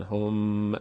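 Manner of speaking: هم with the m dragged and released; a faulty, drawn-out hom; imitated mispronunciation. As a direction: imitate learners saying هم with an unsteady final m.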